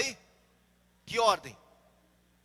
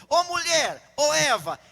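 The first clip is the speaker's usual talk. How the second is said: loud, raised, insinuating challenge; serpent calling Eve; 5 semitones higher, 9 dB louder, slower than usual. Acted. Serpent hails Eve to question God's order, loud drawn-out calling. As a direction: call out to her, then stretch the question. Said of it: "A serpente falou".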